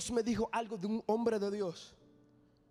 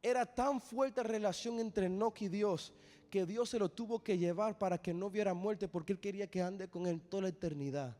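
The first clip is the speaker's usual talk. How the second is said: soft tender close; 3 semitones lower, 4 dB quieter than usual. Real, not acted. Sermon winds down softly, tender.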